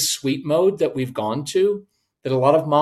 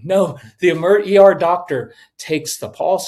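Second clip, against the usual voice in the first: ordinary talk, nothing marked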